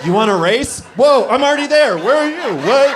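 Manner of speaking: high, loud playground gloating; loud, high teasing brag at the kid; mock taunting dad. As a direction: gloat over a toddler in a race. Taunting toddler, acted dad.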